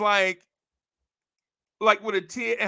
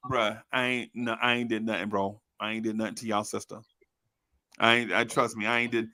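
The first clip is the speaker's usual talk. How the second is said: nervous low denial; 7 semitones lower, 5 dB quieter than usual. Retold or imitated. Defending himself to brothers.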